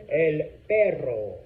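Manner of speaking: slow "El perro" with a drawn-out trilled R; rolled R in perro. Shown as a demonstration. Teacher models for class to repeat, rolled R in "perro".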